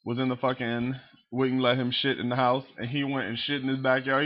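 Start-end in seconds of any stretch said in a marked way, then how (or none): none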